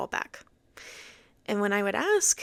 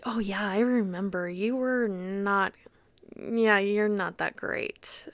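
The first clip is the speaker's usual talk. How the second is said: slow, drawn-out imitation of a director; unimpressed dismissal; slower than usual. Director turning down a remembered actor, unimpressed. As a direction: stretch it out as a reluctant brush-off.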